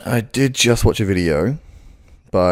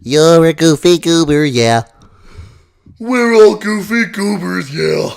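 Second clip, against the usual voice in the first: high, loud cartoon sponge; sing-song cartoon sponge; SpongeBob voice; 10 semitones higher, 6 dB louder, slower than usual. Shown as a demonstration. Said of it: "can I do SpongeBob".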